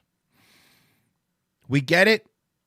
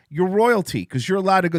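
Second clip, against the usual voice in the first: ordinary delivery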